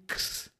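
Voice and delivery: "X" as a voiceless ks, not the letter name; "X" as ks, no vowel; ks sound. Shown as a demonstration. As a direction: sound out "X" as k plus hiss. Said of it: "é o som de X".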